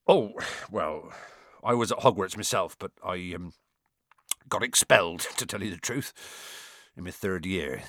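low, hesitant gamekeeper voice; embarrassed Hagrid. A narrator's reading. Hagrid admitting he was expelled, embarrassed. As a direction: confess reluctantly, halting and subdued.

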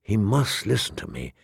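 soft, low thought; will it silently, almost under the breath; hushed, private insistence; inward plea. A narrator's reading. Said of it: "she thought".

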